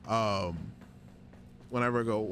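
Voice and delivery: normal talking voice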